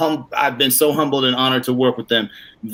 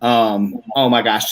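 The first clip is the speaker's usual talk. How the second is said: usual speaking voice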